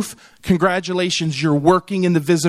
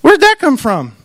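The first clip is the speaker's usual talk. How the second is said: high, loud fake astonishment; hammed-up homeowner surprise; mock surprise; 12 semitones higher, 6 dB louder than usual. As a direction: act shocked at the snow. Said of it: "you go".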